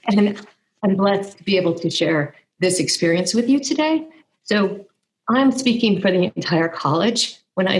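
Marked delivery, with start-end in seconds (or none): none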